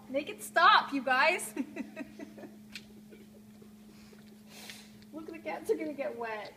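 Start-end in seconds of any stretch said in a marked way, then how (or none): 0.1-1.5 loud, dramatic outburst; loud pretend begging with pitch swoops; mock distressed plea. Mock plea over rained-on cats. 1.6-5.1 long breathy giggle fit; real laughing fit; helpless laughter. Real, not acted. Cracks up at own bit.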